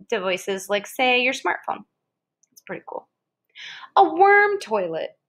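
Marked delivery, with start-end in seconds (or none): 2.5-3.1 whisper. Real, not acted. To the child after reading, whispered aside. 4.0-5.2 much higher, slow, showy heading; grand announcement. Acted. Reading a book to a child, showy announcement.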